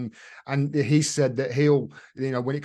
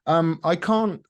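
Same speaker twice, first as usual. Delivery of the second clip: usual speaking voice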